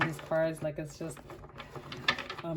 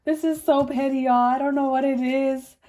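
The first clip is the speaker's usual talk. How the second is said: voice acting weird; 7 semitones higher, 6 dB louder than usual. Real, not acted. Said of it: "my voice is, like, acting weird".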